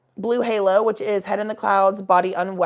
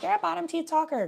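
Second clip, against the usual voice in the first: higher-pitched mock teasing; joking taunt in a raised pitch; teasing friends; 9 semitones higher than usual. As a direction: imitate friends ribbing you. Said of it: "they joke and they're like".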